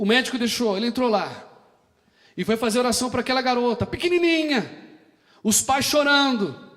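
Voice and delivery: high, loud, driving sermon cadence; full-force preaching. Acted. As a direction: hammer each line to the congregation. Prega a plena força. (pregação inflamada, alta e aguda)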